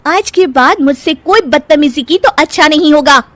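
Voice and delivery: high, firm warning; threatening. Acted. Nisha warning Kirti after twisting her hand, threatening. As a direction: lay down an angry warning.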